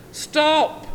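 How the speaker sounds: high, loud yell. A narrator's reading. Lucky the cat yelling, loud high shout. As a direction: cry out after the runner. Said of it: "yelled Lucky".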